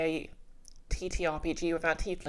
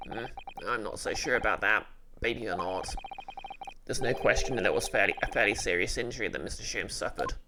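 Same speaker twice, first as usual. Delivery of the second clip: low voice, puzzled; a deep-voiced young man thinking aloud; puzzled male sidekick; 7 semitones lower than usual. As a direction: sidekick voice, pitched low and wondering.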